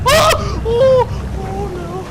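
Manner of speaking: high scream breaking into laughter; panicked and laughing at once; terrified scream. Real, not acted. Monster suddenly close.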